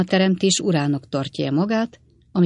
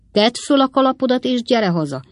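higher, louder command to come home; indignant raised-voice order; stern order; 8 semitones higher, 3 dB louder than usual. A narrator's reading. Wardle ordering his sister home.

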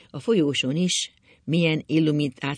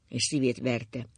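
plain reading voice

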